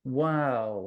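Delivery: ordinary talk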